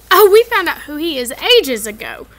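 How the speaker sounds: loud, show-off boast; impressive. Acted. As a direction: show off what you know. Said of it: "said Ron impressively".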